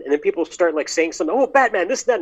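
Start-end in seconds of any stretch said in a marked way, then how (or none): 1.4-2.2 imitating a British accent, pitched up; a hammy British accent, mocking a fan; mild mock British accent with a raised pitch. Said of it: "in a British accent".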